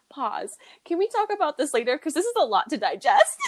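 high, laughing, half-yelled plea to pause; overwhelmed laughing. Retold or imitated. Retelling how she stalled his confession, laughing high. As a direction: laugh through it, overwhelmed and pleading.

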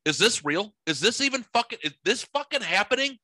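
can't believe your eyes; stunned disbelief. Retold or imitated. A man on his porch, hurried and disbelieving. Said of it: "I was like".

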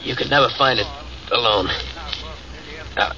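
ordinary delivery

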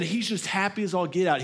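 ordinary talk, nothing marked

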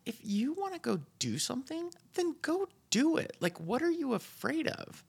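high exasperated disbelief. Retold or imitated. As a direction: throw up your hands at them. Exasperated advice to beginners.

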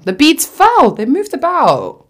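loud, high, slow on moved about; dramatic. Real, not acted. Friend dramatizing the broken bracelet, loud. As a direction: play up the disaster.

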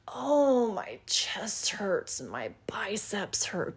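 whispery, drawn-out moan; mock complaining. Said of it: "You know, we complain".

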